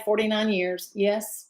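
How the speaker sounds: usual speaking voice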